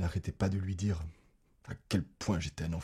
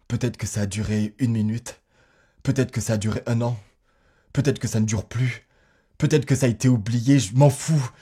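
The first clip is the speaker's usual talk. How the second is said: loud, insistent, building in pitch and force; heated tirade rising to a high, loud peak; defiant outburst; 4 semitones higher, 11 dB louder than usual. Acted. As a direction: hammer each supposition harder, ending in angry dismissal. Defiant outburst about hating mother.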